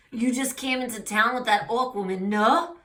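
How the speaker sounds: put-on French accent with a lifted tag question. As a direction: quiz the newcomer in a French accent. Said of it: "oh, he's French".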